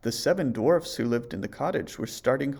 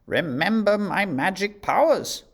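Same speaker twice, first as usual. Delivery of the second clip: high, loud, menacing warning; evil queen's threat; 8 semitones higher, 6 dB louder than usual. A narrator's reading. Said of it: "she warned".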